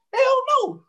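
high, sharp "hell no", pitch jumping right up; flat-out refuse the idea with a big pitch leap; emphatic refusal. Real, not acted. Telling listeners they won't fight for Trump, high emphatic refusal.

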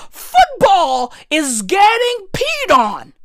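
drawn-out mocking shout; slow gloating shout. Real, not acted. Mocking England's Euros loss.

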